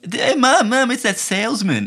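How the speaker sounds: put-on high-pitched little kid calling Mum; excited child. Child telling his mum about the man, high excited voice. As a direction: pipe up like an eager child. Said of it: "You know, he's like".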